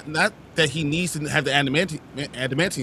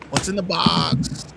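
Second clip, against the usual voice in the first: movie quote; slower than usual. Retold or imitated. Quoting the Se7en line.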